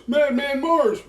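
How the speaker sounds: high, loud, slow chanted yell; shouting Madman Mars over and over; yelling the name. Retold or imitated. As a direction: bellow it at the house, drawn out. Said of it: "just starts yelling".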